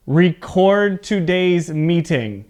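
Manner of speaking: record as ree-CORD, slow teaching pace; record said re-CORD in a slow example phrase; verb stress on second syllable. Shown as a demonstration. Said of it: "So my voice goes up".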